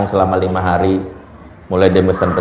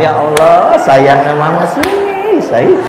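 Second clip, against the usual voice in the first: doting mother; 7 semitones higher, 4 dB louder, much slower than usual. Retold or imitated. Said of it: "Lagi habis murojaah hafalan".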